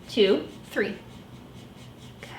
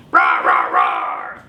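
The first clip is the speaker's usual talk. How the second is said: monster roar; slower than usual. Acted. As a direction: roar like a beast. Baby roaring.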